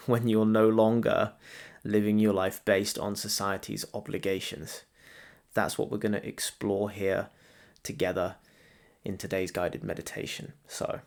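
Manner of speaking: ordinary delivery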